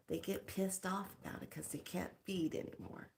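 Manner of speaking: ordinary talk, nothing marked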